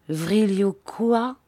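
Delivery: slow, questioning echo; puzzled questioning. A narrator's reading. Bobby asks about an unknown word, puzzled. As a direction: query the strange word, voice rising. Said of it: "cria Bobby d'un ton interrogateur".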